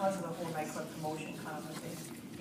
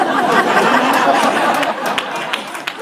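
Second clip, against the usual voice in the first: breathy laugh; 6 semitones higher, 16 dB louder than usual. Real, not acted. Laughs about brother's slides.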